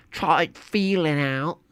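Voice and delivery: put-on lightly done British accent; mock British accent. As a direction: feel your way into a British accent. Trying accent by feel.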